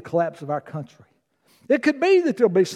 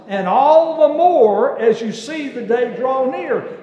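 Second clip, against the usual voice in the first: slow and louder, higher on all the more; stressed scripture; 5 semitones higher, 5 dB louder, slower than usual. Real, not acted. Pastor pointing the church to the key verse, emphatic.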